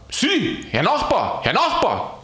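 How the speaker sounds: high-pitched hail to a passer-by; hammed-up high cry for attention; calling out. A narrator's reading. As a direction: call after someone, voice jumping up. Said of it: "schrie Aloysius".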